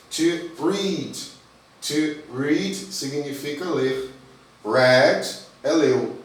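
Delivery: slow, drawn-out explanation; slow teaching. Shown as a demonstration. Teacher to students, slowly explaining read. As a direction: spell it out slowly for beginners. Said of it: "teacher slowing for students".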